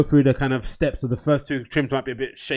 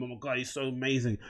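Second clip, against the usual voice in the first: put-on swooning girl, much softer; mock adoration; 12 dB quieter than usual. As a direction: gush softly like a smitten girl. Girls swooning over decent guy.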